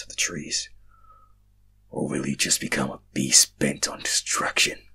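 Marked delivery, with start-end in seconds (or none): 1.9-4.8 low, slow, breathy whisper of a hidden being; deep whisper. Acted. Mysterious voice warns the dreamer, low whisper. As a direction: menace them from the dark in a low whisper. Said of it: "the voice said".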